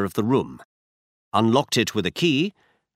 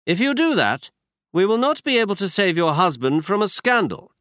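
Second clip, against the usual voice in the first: brisk, higher-pitched detective voice; Holmes voiced higher; 9 semitones higher than usual. A narrator's reading. Holmes tells Watson where they go, higher detective voice. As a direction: voice Holmes up high, assured. Holmes warns Lady Hilda.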